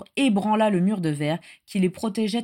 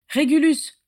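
high, urgent shout of the name; scolding call; 8 semitones higher, 4 dB louder, slower than usual. A narrator's reading. Father hurrying his son away, scolding. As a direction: chivvy him loudly. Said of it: "le houspilla Orion".

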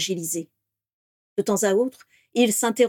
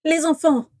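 breathless; 8 semitones higher, 4 dB louder than usual. Acted. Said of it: "dit-elle, le souffle court".

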